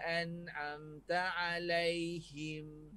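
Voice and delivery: siratal ladzina an'amta alaihim chanted slowly; model Quranic recitation. Shown as a demonstration. Ustaz modelling the verse slowly for students to copy. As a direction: recite slowly, each letter from its makhraj. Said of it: "seperti itu".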